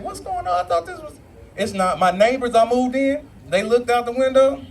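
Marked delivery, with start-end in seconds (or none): 0.0-1.2 high, soft, baffled mock question; mock confused. Comic seeing flags on the corner, confused. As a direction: play it puzzled and thrown.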